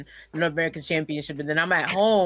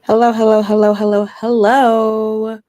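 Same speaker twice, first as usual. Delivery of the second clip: loud, higher sing-song hello check; calling hello; 6 semitones higher, 11 dB louder than usual. Real, not acted. Host testing the mic, calling loud hello. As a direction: call out to see if the mic is on.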